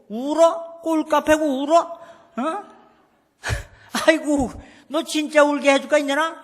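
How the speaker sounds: mock the girl for crying; mocking mother. Mother mocks crying daughter.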